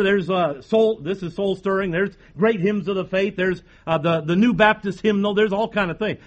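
ordinary talk

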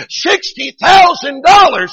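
slow, loud pulpit proclamation; big revival climax; preacher's shout. Retold or imitated. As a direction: shout the sum out, stretching it.

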